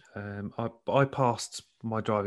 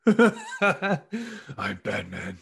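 slow, higher mock superhero voice; amused copy of a superhero voice; 5 semitones higher, much slower than usual. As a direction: echo the vigilante impression, drawn out.

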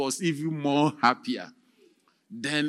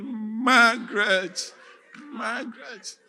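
heartbroken call; 8 semitones higher, 3 dB louder, slower than usual. Acted. Heartbroken prisoner calling his wife.

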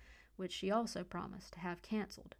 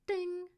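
high, ringing voiced "ding"; phone notification ding; 12 semitones higher, 7 dB louder than usual. A narrator's reading. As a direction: imitate a phone alert chime. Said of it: "Leah's cell dinged with a flurry".

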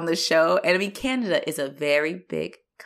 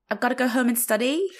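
higher, with "study" rising like a question; statement as question; 5 semitones higher than usual. Retold or imitated. Mimicking a girl's statement that sounds asked, rising on "study". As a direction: imitate upspeak, lifting "study" at the end. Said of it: "She's saying a statement".

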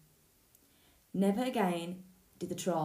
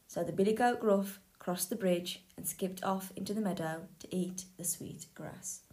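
ordinary delivery, nothing marked

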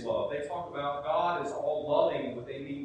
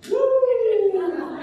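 high, loud worship whoop; joyful whoop; 14 dB louder than usual. Retold or imitated. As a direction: let out a big church whoop. Church member shouting in worship.

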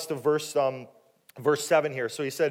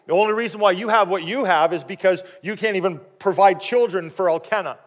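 loud, higher-pitched needling taunt; 5 semitones higher, 8 dB louder than usual. Acted. Rival wife provoking childless Hannah, taunting. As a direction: rub it in, acting out a cruel rival. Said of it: "it would be in a way of".

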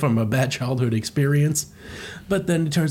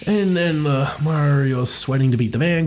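ordinary talk, nothing marked

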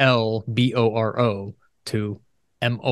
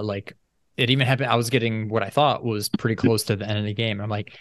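ordinary talk, nothing marked

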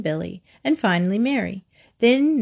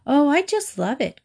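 delighted; 6 semitones higher than usual. A narrator's reading. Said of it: "Cornelia whispered back".

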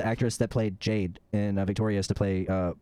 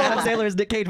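normal talking voice

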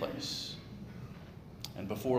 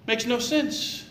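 emphatic; 12 semitones higher, 6 dB louder, slower than usual. Real, not acted. Preacher's emphatic punchline.

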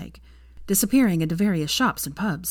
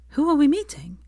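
high, quick and worried; anxious; 10 semitones higher, 3 dB louder than usual. A narrator's reading. Hermione nervously asking Draco who they meet. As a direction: ask with rising panic. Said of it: "she asked anxiously".

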